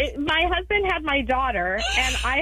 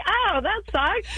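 higher, slow, careless voice; imitating the husband; 8 semitones higher, slower than usual. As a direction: shrug it off. Husband's shrug.

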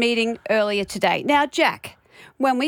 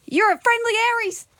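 high-pitched excited call-out; retold yell; 12 semitones higher than usual. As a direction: yell it out as if across the meeting, high. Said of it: "I just yelled at her".

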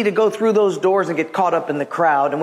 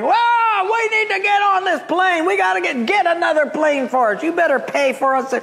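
mock angry yelling crowd; 12 semitones higher than usual. Passengers yelling at the airline desk, hammed-up high mock yell. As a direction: play the furious crowd at the gate, half in jest. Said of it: "two hundred people back are yelling".

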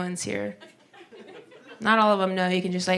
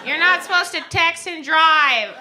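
loud, higher, slow and scolding; mock scolding lady, louder and higher; annoyed lady; 10 semitones higher, 4 dB louder than usual. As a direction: lecture him like an indignant churchgoer. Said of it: "she was like".

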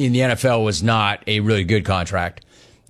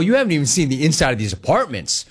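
usual speaking voice

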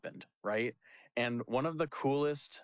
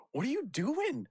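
much higher, mock-exasperated; incredulous disbelief; 13 semitones higher than usual. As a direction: throw up your hands at his choice. Baffled pastor retelling the exchange.

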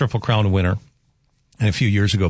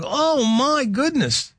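amazed; 14 semitones higher, much slower than usual. Retold or imitated. Retold reaction to the man.

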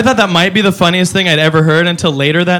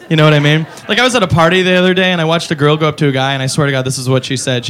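ordinary delivery

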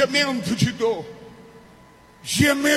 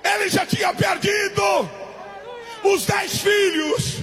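raised, driving preacher's shout; full-force preaching; 7 semitones higher than usual. Acted. Preacher telling how the devil despairs at Job's worship, full-force preaching. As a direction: cry it out to the congregation.

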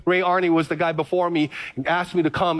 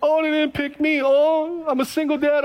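higher, whining excuses in a put-on voice; a mocking sketch of a pouting excuse-maker; mock whiny self-pity; 7 semitones higher than usual. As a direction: lightly mimic someone feeling sorry for themselves.

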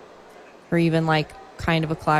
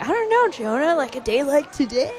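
playful sing-song pondering; mock wistful musing; 9 semitones higher than usual. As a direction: pretend to muse dreamily, pitched way up. Imagined self gazing out window.

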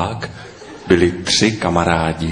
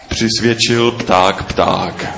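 ordinary delivery

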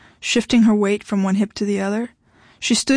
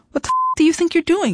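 high, indignant outburst; angry demand; 9 semitones higher, faster than usual. A narrator's reading. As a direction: demand it in a raised, outraged pitch. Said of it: "she demanded".

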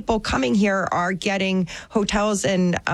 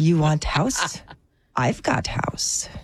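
put-on slow, hushed Eastern European accent; 5 semitones lower, slower than usual. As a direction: sell it low in a thick Eastern European accent. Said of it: "Is this Eastern European?".

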